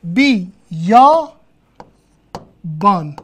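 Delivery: بی، یا، بان slowly, each syllable on its own. Shown as a demonstration. Teacher repeating our pronunciation, syllable by syllable. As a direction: separate بی، یا، بان with pauses. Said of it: "تلفظمون بیابانه".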